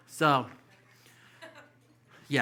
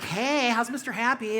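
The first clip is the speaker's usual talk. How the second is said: high, slow, sing-song flamboyant guy; flamboyant mimic; 10 semitones higher than usual. Comic mimics the guy asking about Mister Happy, high and slow. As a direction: lilt it out like a flamboyant flirt.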